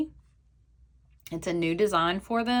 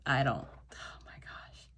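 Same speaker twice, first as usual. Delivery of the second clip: awed whisper; 5 semitones lower, 9 dB quieter than usual. Real, not acted. Opening the package, awed.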